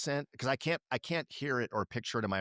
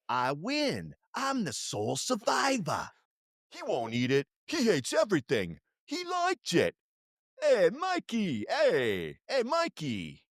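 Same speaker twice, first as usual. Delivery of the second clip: put-on high, loud kid with Jersey accent; hammed-up Jersey kid from an ad; 6 semitones higher, 4 dB louder, slower than usual. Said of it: "super thick Jersey accent".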